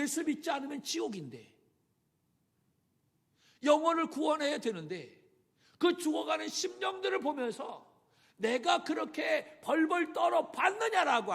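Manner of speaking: loud, high fervent sermon shout; impassioned preacher's outcry; preaching at full force. Acted. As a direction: thunder it at the congregation. Preaching climax.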